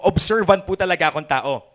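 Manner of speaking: usual speaking voice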